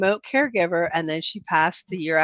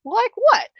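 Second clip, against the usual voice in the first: high, quick perked-up question; curious surprise; 13 semitones higher, 3 dB louder than usual. Retold or imitated. Hearing her friend's side-project idea, perked-up surprise. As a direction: snap to attention, intrigued. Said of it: "I'm like".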